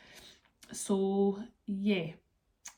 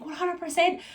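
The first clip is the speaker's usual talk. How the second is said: higher, eager imitation of the boyfriend; eager yes; 10 semitones higher, 5 dB louder than usual. Boyfriend promising to help on Friday, eager. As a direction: chirp it up keenly like he agreed.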